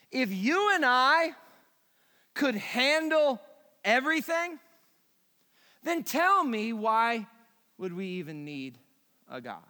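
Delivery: usual speaking voice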